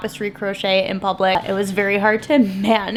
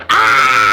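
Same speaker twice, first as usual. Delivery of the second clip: frustrated groan; 13 semitones lower, 6 dB louder than usual. Real, not acted. Frustrated at mistake.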